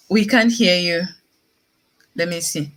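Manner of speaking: normal talking voice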